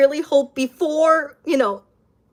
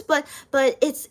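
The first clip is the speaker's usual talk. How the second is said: ordinary talk, nothing marked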